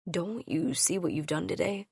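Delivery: softly. A narrator's reading. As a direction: ask it gently and tenderly. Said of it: "he asked me softly".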